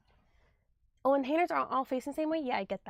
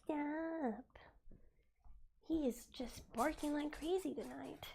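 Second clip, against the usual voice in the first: whispering; 2 semitones higher, 12 dB quieter than usual. Real, not acted. Whispering to the dog.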